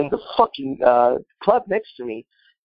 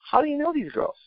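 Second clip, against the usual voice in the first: incredulous question; 5 dB quieter than usual. Retold or imitated. Asking friend in disbelief.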